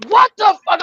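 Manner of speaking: loud high "what the fuck"; mimicking a coworker's startled shout; imitated outburst. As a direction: blurt it out in shock. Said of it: "I just hear him go".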